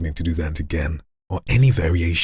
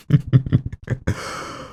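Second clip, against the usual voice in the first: unvoiced cackle; 7 semitones higher than usual. Voice demo reel.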